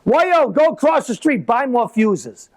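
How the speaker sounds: high call up the stairs; father yells up. Retold or imitated. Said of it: "my father yells up".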